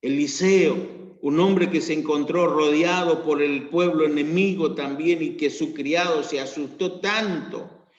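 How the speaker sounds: loud, driving sermon delivery; preaching at full force. Acted. Pastor preaching to his church, loud and driving. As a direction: proclaim it to the congregation.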